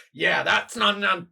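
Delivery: high, loud, fast tough-guy boast; defiant hero. Acted. Crab hero boasts to the rat monster, high and fast. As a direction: brush off the attack and charge on.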